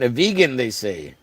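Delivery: ordinary delivery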